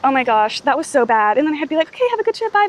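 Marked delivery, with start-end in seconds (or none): 1.9-2.7 high-pitched, over-polite mock goodbye; imitated polite farewell. Retelling her goodbye to two strangers, high polite farewell. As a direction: pitch it up into a retold nicety.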